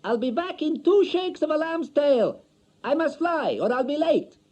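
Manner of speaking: high, loud parting call; wife leaving for good; hurried wife calling. A narrator's reading. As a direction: call out while rushing away. Wife hurrying out.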